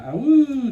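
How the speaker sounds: high, loud train whoo; train whistle imitation. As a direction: call it like a far train whistle.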